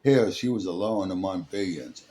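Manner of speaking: plain reading voice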